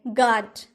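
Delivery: गार्ड with plain g; silent u. Shown as a demonstration. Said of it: "pronounce गार्ड बोलते हैं". (गार्ड, यू मौन)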